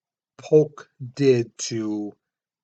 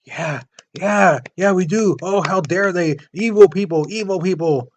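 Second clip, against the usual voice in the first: higher, louder mock zealous agreeing; eager agreement; 5 semitones higher, 6 dB louder than usual. Congress cheering Polk on, mock eager agreement. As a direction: egg him on like an eager crowd.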